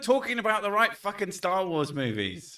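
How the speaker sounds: high-pitched, exasperated correction; indignant protest. Real, not acted. Fan correcting a friend about Star Wars movies, indignant. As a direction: cut in, indignant, pitched right up.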